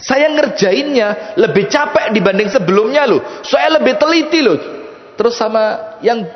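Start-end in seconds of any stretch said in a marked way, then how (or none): 0.0-4.6 higher-pitched, startled objection; startled protest. Acted. Worshipper defends the cloth to the buyer, startled protest. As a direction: protest in surprise, voice raised in pitch. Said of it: "Ini ahli ibadah kuaget".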